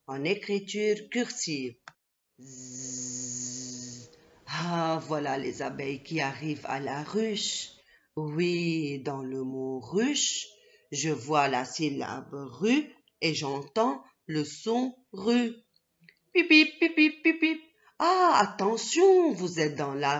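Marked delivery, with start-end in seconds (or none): 2.4-4.1 long, low, soft bee buzz; imitated bees buzzing. Imitates bees arriving at the hive. 16.3-17.6 high, loud beeping; quick high-pitched toots like a little car; honk-honk noise made by voice; imitated car horn. Imitates car horns in the street. 18.0-19.3 high, loud warning cry; playful alarmed shout; mock alarm. Pretend warning to children in traffic.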